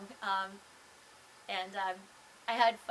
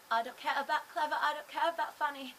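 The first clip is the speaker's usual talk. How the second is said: exaggerated Cockney, pitched up and louder; mock Cockney accent; 5 semitones higher, 5 dB louder than usual. Shown as a demonstration. Vlogger showing the accent she didn't use, mock Cockney. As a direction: put on a thick Cockney. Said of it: "do the accent a little bit ... the Cockney thing".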